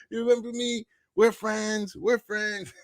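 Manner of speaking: mock-plead you're old friends; clingy fan. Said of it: "I'm gonna be that dude going over like".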